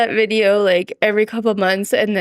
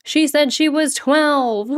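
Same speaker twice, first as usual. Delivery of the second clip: high, slow mock quote; mimicking the video; 6 semitones higher, slower than usual. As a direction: mimic the guy from the video, drawn out. Imitating the viral video quote.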